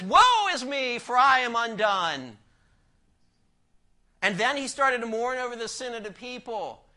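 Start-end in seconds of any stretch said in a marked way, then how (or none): 0.0-2.4 louder, higher cry of woe; a hint of a stricken Isaiah; Isaiah's lament. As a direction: cry it out like a prophet undone. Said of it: "Isaiah ... he says".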